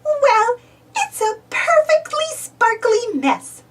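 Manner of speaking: high, slow amused reply; aunt forgiving the mess with a smile; smiling. A narrator's reading. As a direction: say it with a smile you can hear. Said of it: "Minnie says with a smile".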